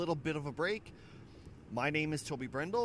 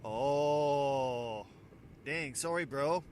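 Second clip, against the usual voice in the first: louder, drawn-out oh into a sorry; apologetic stranger; 5 dB louder, much slower than usual. Retold or imitated. Stranger realising his mistake, louder apology. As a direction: stretch the oh, then apologise. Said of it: "C J pipes up and goes".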